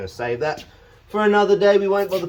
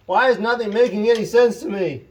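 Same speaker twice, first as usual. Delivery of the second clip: exasperated; 8 semitones higher, 6 dB louder than usual. Real, not acted. Frustrated at the fitting.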